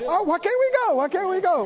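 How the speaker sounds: high, whining mock complaint; whiny mocking. Preacher mocking passengers who want to fly, whiny. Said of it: "They're like".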